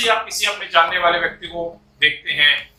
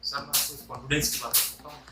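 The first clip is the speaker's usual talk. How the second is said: low, very soft, near-whispered confidence; hushed aside, much softer and lower; confidential hushed tone; 4 semitones lower, 7 dB quieter than usual. Shown as a demonstration. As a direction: drop your voice as if sharing a secret. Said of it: "confidential बात कर रहे हैं तो हम धीरे धीरे बात करेंगे".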